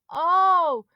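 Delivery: high, loud teasing call; boy taunting through cupped hands; mock calling. A narrator's reading. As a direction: call the shark like a pet. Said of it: "Monty called through cupped hands".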